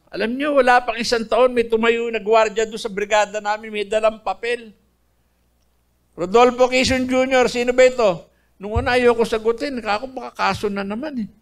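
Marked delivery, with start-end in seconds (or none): 6.2-8.2 louder, raised roll-call voice; guard calling out. Retold or imitated. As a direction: call the name across the cell block. Guard calls out prisoner's name.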